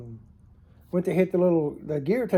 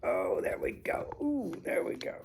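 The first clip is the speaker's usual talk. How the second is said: whispered aside; 9 dB quieter than usual. Real, not acted. Fixing camera, muttering.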